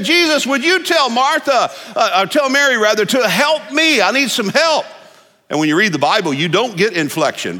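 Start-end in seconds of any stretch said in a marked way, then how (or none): 0.0-4.8 high-pitched, stressed plea to Jesus; mock frantic Martha. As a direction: play the frazzled hostess calling out. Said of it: "she yells out there to Jesus".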